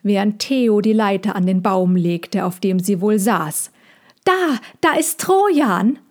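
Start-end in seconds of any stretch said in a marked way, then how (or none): 4.2-6.0 high excited cry; excited call. A narrator's reading. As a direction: call out the sighting eagerly. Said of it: "rief Mina".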